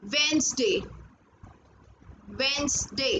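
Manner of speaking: Wednesday said as "Wenz-day", silent first d; correct pronunciation. Shown as a demonstration. Teacher correcting a common error: Wednesday as "Wenz-day". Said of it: "It's Wednesday, not".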